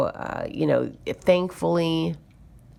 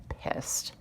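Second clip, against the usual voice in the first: a hushed whisper of the word; 13 dB quieter than usual. Real, not acted. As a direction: whisper it as if it were a naughty word. Telling how angry she was.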